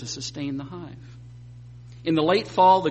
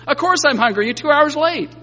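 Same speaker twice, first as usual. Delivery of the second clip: higher and louder, a put-upon dog complaining; indignant talking dog; 10 semitones higher, 8 dB louder than usual. Pretend. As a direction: play the dog scolding its late owner, high and loud. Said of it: "the dog looks up and he says".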